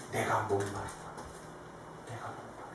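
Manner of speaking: low, very soft, fading to a whisper; dying-away hushed voice; faint, spent plea. Acted. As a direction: say it weakly, then repeat it barely audibly. Jesus thirsting on the cross.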